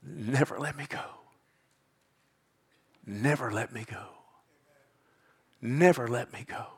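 soft, repeated prayerful plea; hushed plea. Acted. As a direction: murmur it like a private prayer.

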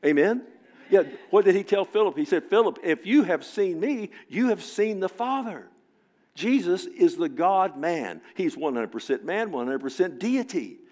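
loud, high, rousing sermon voice; fiery preaching. Acted. Preacher rousing the congregation about divided families, loud and fervent. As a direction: preach it out to the whole church.

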